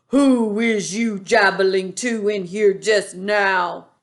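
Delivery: slow, loud menacing boom; booming demand. A narrator's reading. Bloodbottler looms over the BFG demanding answers. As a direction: boom down at the smaller giant. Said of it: "he boomed".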